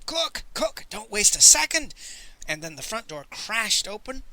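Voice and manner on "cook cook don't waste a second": high, urgent calls getting louder; urgent scream. A narrator's reading. Miser summoning his cook in haste, screaming. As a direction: scream for help in short high bursts, then push harder. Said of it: "I heard him scream".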